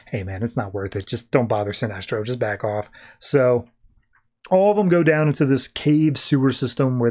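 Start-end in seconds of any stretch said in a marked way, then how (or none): 0.1-2.9 softer, low-key aside; quiet cautioning aside; hushed warning. Acted. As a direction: murmur it so the boss won't hear. Korugarian quietly warning Arkillo.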